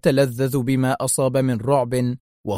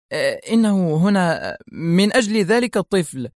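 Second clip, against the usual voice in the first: higher, tearful tremble; trembling voice; 5 semitones higher than usual. A narrator's reading. Secretary tells principal whom the visitor wants, trembling. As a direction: stammer it out like a frightened secretary. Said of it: "قائلة بنفس الصوت المرتجف".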